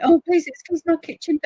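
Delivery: high, loud flustered apology. Retold or imitated. To a visitor at the door, flustered and apologetic.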